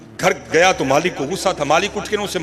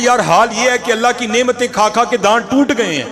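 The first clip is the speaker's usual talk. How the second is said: raised, emphatic wise answer; sage's reply; 7 semitones higher, 6 dB louder than usual. Acted. Saadi answering how he is, raised and emphatic. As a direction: deliver the sage's witty answer with force.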